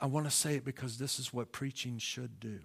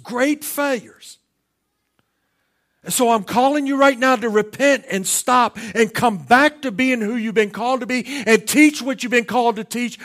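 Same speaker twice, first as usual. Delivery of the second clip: loud, high, fiery altar call; preaching at full force; 10 semitones higher, 18 dB louder than usual. Acted. Pastor warning divisive preachers to repent, preaching at full force. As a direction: thunder the warning at them.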